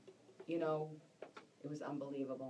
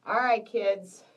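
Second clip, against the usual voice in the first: calling out; 3 semitones higher, 10 dB louder, slower than usual. Real, not acted. Rallying the viewers before going live.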